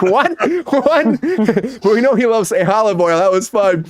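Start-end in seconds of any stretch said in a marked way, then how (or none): 0.0-1.2 mock surprise. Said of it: "Stanley Tucci was like".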